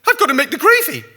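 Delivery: very high, loud laughing Thatcher excuse; hammed-up Thatcher fleeing to the kitchen; panicked Thatcher. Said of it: "panicked again".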